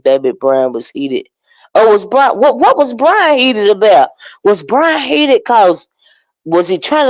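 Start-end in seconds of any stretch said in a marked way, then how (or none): none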